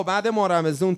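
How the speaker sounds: usual speaking voice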